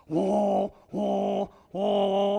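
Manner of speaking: loud cartoon "wah" of boiling rage; cartoon anger wail. Preacher imitating a cartoon character's angry wail. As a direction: wail like an old cartoon character blowing his top. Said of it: "Remember those old cartoons?".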